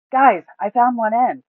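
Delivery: loud call across the hill; shout. A narrator's reading. Gub calling to the party across the hill, shouting. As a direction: call out to the others. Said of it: "he shouts to the rest".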